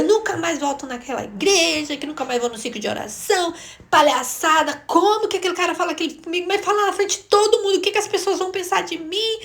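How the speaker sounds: high, indignant retold rant; angry ranting. Woman raging at home about being shamed before everyone, indignant and high. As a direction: act out coming home fuming, voice climbing as it goes. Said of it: "cheguei brava, brava, brava, brava".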